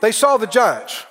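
ordinary delivery

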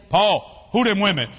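loud mock challenge from mama; loud, hammed-up interrogation; imitating indignant mama. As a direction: demand it loudly, as an imitated wife. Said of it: "I know what Mama gonna say".

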